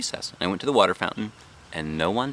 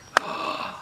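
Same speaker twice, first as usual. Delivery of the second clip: surprised gasp; 5 dB quieter than usual. A narrator's reading. Seeing new haircut in mirror.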